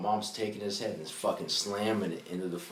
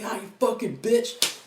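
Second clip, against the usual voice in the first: much higher, slow strained snarl; retold furious curse; 12 semitones higher, 4 dB louder, slower than usual. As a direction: imitate him cursing through the pain. Stepdad cursing mom during fight.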